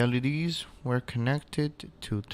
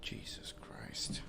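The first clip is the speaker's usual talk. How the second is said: whispered under breath; real whispered despair; exasperated whisper; 12 dB quieter than usual. Real, not acted. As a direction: breathe it out in disbelief.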